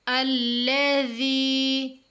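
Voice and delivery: drawn-out recited word; slow recitation. Shown as a demonstration. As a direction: stretch it out as in tilawat. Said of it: "जाल या ज़ेर जी". (धीमी तिलावत)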